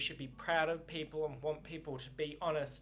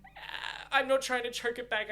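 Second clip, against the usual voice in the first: higher and louder, voice catching near tears; holding back tears; 5 semitones higher, 6 dB louder than usual. Real, not acted. Reader moved by the scene, close to crying. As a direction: fight the tears while getting the words out. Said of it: "oh no, I'm gonna start crying".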